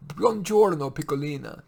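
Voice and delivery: Italian maid calling out. Acted. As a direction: call it down the hallway like a cheerful maid.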